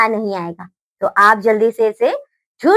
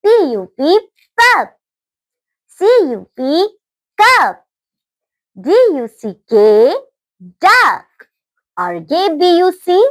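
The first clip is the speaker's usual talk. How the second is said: read aloud; 2 semitones higher, slower than usual. Shown as a demonstration. Teacher reading phonics words aloud to children, clear and emphatic. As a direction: drill the letters, then call out the whole word. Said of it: "यानी कि जोर जोर से बोलना है हमें".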